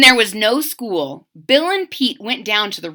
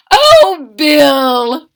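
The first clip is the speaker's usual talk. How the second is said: beaming; 6 semitones higher, 11 dB louder than usual. Acted. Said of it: "Mama beamed".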